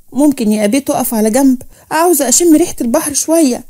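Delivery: ordinary delivery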